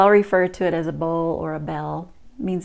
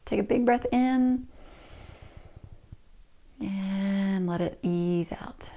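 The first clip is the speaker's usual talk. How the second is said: guided meditation; 5 dB quieter than usual. Shown as a demonstration. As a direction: guide them into stillness. Leads the mindful body practice.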